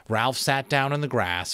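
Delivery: usual speaking voice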